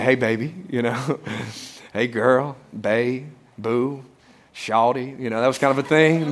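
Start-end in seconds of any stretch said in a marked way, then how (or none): 0.0-0.5 mock smooth talker. Sweet-talking upset wife. 1.9-5.2 lower, softer crooning pet names; hammed-up ladies' man; husband sweet-talking his upset wife, lower and softer; mock smooth talker.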